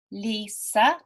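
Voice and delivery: "Lisa" in two beats, Li-sa; teacher marking the two parts of "Lisa"; split into syllables. Shown as a demonstration. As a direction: stretch "Lisa" so both syllables stand apart. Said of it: "because it has two syllables".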